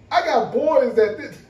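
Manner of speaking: ordinary talk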